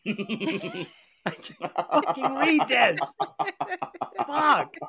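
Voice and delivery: slow, laughing through the words at his own stumbling; laughing frustration. Real, not acted. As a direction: give up on the text, laughing. Stumbling over text, laughing.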